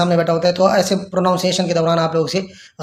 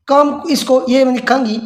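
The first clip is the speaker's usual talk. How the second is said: ordinary talk, nothing marked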